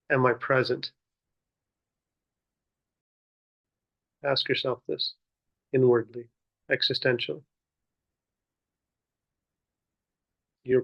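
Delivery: measured meditation guidance with long pauses; guided meditation. Real, not acted. Teacher leading seated listeners in muraqabah, guiding contemplatively. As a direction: guide them inward, one unhurried phrase at a time.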